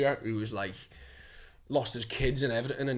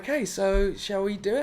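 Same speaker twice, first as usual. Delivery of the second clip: higher voice, imitating the casting woman; lightly voiced young actress, pitched up; 5 semitones higher than usual. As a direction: mimic her polite prompt. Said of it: "she was like".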